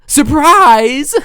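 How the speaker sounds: loud, high shout of surprise; sudden booming mock-party shout; gleeful villain yell. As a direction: yell it out with drawn-out theatrical force. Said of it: "she couldn't hear me yell".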